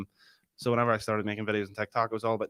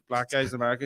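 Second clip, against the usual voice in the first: ordinary delivery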